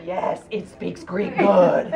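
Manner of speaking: slow, pointed mock retort; mock pissed-off retort. Tourist snapping back at the smoking woman, slow mock retort. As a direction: throw it back word by word. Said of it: "I was so pissed off at her".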